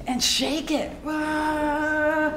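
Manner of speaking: short mock yell of frustration; a playful burst of pent-up anger; shaking release yell. Shown as a demonstration. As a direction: let out a quick wah as you shake. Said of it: "Making some sounds".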